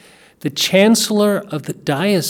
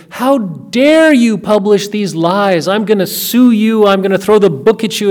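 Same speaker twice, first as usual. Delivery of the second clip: louder, higher mock-angry tirade; furious screaming; 4 semitones higher, 5 dB louder than usual. Angry wife screaming at Mom, a retold outburst. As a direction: hurl the threats like an outraged wife. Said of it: "she screamed at".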